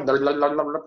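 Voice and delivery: rattled-off babble of a fast talkback voice; imitated rapid screen reader. Preacher imitates a listener's phone talkback, rapid babble. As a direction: mimic speech run together into a blur. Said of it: "Reta yang baca apa, yang talkbacknya".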